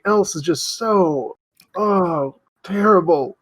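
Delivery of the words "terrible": normal talking voice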